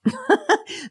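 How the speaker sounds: short high-pitched laugh; quick laugh at own silliness. Real, not acted. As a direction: burst out in a brief high giggle. Laughs after her accent attempt.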